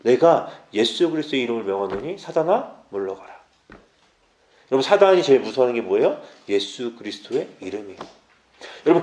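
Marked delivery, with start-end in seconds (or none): 0.0-3.3 low, soft prayer of command; quiet rebuke. Shown as a demonstration. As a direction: command quietly, dropping away at the end. 대적 기도를 시연. (낮고 조용하게 물리치는 기도)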